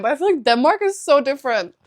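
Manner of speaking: usual speaking voice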